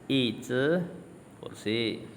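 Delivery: usual speaking voice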